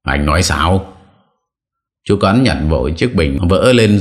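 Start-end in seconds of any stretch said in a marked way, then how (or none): none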